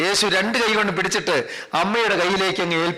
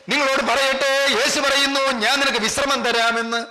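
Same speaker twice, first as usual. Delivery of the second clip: driving, sustained preacher's chant, building higher; impassioned preaching; 7 semitones higher than usual. Real, not acted. Preacher promising the congregation that God restores what was lost, impassioned.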